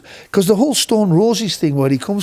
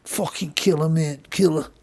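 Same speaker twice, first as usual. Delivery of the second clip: soft, offhand stoner voice; 6 dB quieter, slower than usual. Retold or imitated. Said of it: "he'd just go like".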